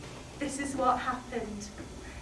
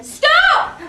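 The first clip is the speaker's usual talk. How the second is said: very high, very loud sudden cry; screaming stop at the climax; shouted stop; 13 dB louder than usual. Acted. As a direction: slam on the brakes with a scream. Sudden outburst.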